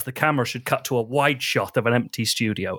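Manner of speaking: ordinary talk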